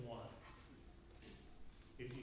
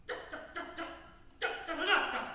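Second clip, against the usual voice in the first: loud, short punched da syllables; marcato eighths; 10 semitones higher, 5 dB louder, much faster than usual. Shown as a demonstration. Conductor shows woodwinds the eighths, extremely marcato. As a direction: fire off extremely short, hard das. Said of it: "extremely marcato eights".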